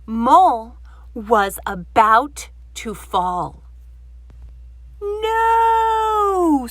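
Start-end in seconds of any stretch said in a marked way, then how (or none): 5.0-6.7 long, much higher and louder scream of panic; waking from a nightmare in terror. Acted. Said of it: "screamed Grumpy Badger".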